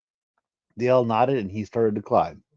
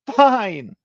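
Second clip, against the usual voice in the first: much higher, louder sobbed word; 13 semitones higher, 6 dB louder than usual. Acted. The Kashara gives in to Genesis, sobbing high. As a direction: choke it out tearfully. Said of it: "the Kashara sobbed".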